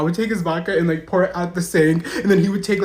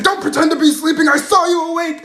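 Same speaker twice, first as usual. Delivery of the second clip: raised, loud scolding yell; yelling sergeant; 8 semitones higher, 4 dB louder than usual. Retold or imitated. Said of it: "literally yelling at me".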